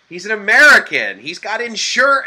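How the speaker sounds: high, loud eager voice; imitating the nurse. Nurse telling the clerk he's American, high and eager. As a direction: put on an excited nurse realizing he's insured. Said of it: "She's like".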